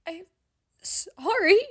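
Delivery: high and loud, sobbing out the apology; choked sob. A narrator's reading. Hazel Lou crying her apology into his neck, high sobbing. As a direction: cry it out, breaking. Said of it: "a choked sob".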